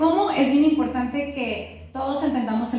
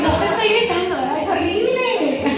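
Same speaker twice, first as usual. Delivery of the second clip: hammed-up outraged protest, louder and higher; mock indignant yell; 6 semitones higher, 4 dB louder than usual. Wife chasing husband down the hall: hammed-up loud protest. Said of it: "lo seguí".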